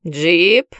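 hoarse hungover voice; hungover rasp. Acted. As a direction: rasp it out, still half drunk. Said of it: "сиплым похмельным голосом". (сиплый похмельный голос)

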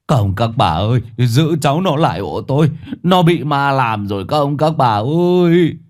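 a villager yelling that he's possessed; shouting for help. Acted. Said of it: "gào lên". (gào lên gọi dân làng)

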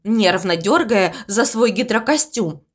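ordinary delivery, nothing marked

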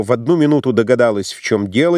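ordinary delivery

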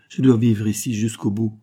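ordinary delivery, nothing marked

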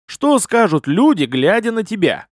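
high-pitched reproachful question; stern lecturing. A narrator's reading. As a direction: keep scolding him strictly. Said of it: "строго продолжил я свою нотацию". (строгая нотация)

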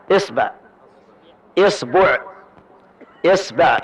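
إصبع said with i on hamza, the ba varied each time; kasra on hamza. Shown as a demonstration. Teacher lists the readings of إصبع with kasra on hamza. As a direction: read إصبع three ways, each starting with i. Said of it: "نأخذ كسر الهمزة".

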